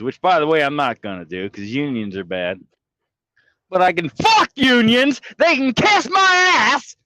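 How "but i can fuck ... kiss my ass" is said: fake mad. Streamer faking rage at the unions for chat. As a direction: yell it as a put-on tantrum. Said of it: "I can be fake mad".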